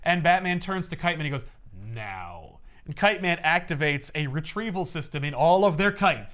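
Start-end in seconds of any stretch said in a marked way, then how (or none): 1.7-2.6 a low, quiet one-word order; low command. Retold or imitated. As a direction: drop the voice for a terse signal. Batman gives Kite Man the signal.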